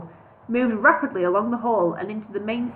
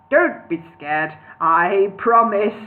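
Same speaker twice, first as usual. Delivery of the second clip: chuckling; 4 dB louder, slower than usual. Acted. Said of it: "chuckling to himself".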